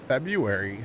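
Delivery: usual speaking voice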